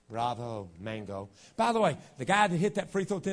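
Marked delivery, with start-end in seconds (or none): 0.1-1.3 low, soft, indifferent applause; mock unexcited cheer. Preacher imitating an unmoved fan praising the scorer, deadpan. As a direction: congratulate with no enthusiasm at all.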